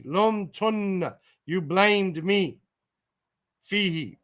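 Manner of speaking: normal talking voice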